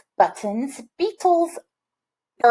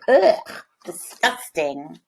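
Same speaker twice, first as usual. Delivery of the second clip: disgusted; 3 semitones lower, slower than usual. Real, not acted. Reacting to the picture.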